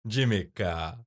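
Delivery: low Jamaican-accent attempt. Shown as a demonstration. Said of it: "Do you want me to do that as well?".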